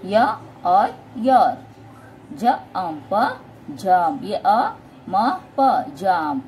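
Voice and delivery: "j, ump" in separate pieces with pauses, then "jump" whole; phonic sounding-out. Shown as a demonstration. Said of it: "फोनिकली बच्चों को सिखा रहे हो".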